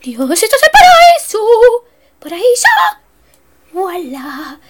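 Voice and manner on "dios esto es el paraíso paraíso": high, loud rapturous cry; delighted exclamation. Real, not acted. Gamer finding a feast, crying out in rapture. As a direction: gush with delight.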